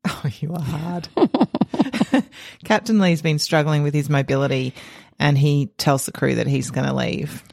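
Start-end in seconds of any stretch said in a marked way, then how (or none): 1.1-2.2 high, bright burst of laughter. Real, not acted. Co-host teasing, bursts out laughing.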